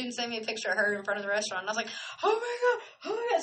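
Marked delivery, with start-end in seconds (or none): 2.2-3.4 high squealing oh my God with a laugh; excited squeal. Retold or imitated. Vlogger retelling her reaction to the photo, high excited squeal. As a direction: squeal like the picture just made your day.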